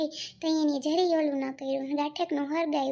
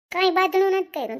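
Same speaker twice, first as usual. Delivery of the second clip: loud, fast, higher protest; angry retort; 4 semitones higher, 7 dB louder, faster than usual. Acted. Daughter-in-law denies the mother-in-law's charge, loud and fast. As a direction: fire back loudly, fast and high.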